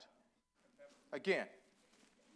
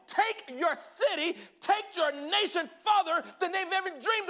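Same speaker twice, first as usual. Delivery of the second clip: higher and louder, climbing to a shouted close; preaching building to a shout; 10 semitones higher, 7 dB louder than usual. Acted. Preacher rousing the congregation about salt and answered prayers, shouting. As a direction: build the sermon to a roar.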